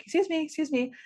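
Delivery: high, light calling to get attention; lightly voiced woman calling excuse me. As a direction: mimic a stranger trying to catch your eye. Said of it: "a woman's like".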